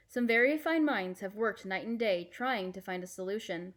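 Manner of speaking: usual speaking voice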